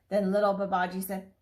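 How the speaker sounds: ordinary delivery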